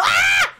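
sudden loud high scream; sharp high shriek; startled scream. Real, not acted. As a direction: scream as if jumped. Startled mid-sentence.

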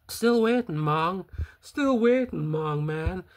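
high, loud sing-song jeer; mocking taunt. Real, not acted. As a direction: rub it in, mocking. Taunting the convicted man.

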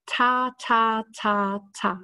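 "Ta, ta, ta, ta" as short, crisp, separate tongue taps; tonguing syllables. Shown as a demonstration. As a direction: tap out each "ta" with the tip of the tongue. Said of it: "I use my tongue and I say".

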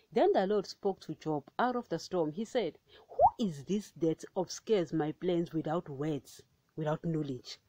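measured, solemn Bible reading; scripture reading. Acted. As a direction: read it as the word of God. Reads Job 38 aloud.

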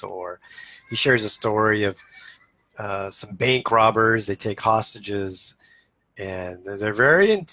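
ordinary talk, nothing marked

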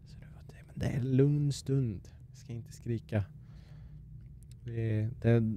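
soft, kept-down voice; hushed. Real, not acted. Streamer told not to scream with son asleep, hushed. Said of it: "nu måste vi vara lite tysta".

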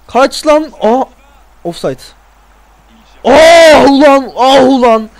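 loud drawn-out groan of frustration; frustrated shout. Real, not acted. Gamer yelling at the screen after an offside. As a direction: yell ah ulan in real annoyance. Offside ruins his attack.

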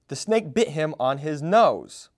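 ordinary delivery

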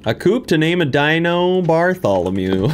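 usual speaking voice

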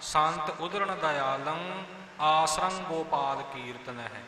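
slow chant with ਉਦਰਣ short, no extra vowel; sung demo of ਉਦਰਣ; correct recitation. Shown as a demonstration. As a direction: model the right ਉਦਰਣ for the congregation. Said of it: "ਉਦਰਣ ਹੀ ਆਵੇਗਾ".

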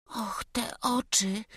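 slow, terrified whisper; frightened whisper. Acted. The boy staring at the stranger, whispering in fear. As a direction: breathe it out in dread. Boy sees the pilgrim's eyes.

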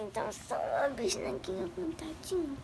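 drowsy whispered replies; half-asleep murmur; sleepy whisper. Real, not acted.

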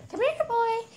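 high puppy-calling voice; calling the dog. Real, not acted.

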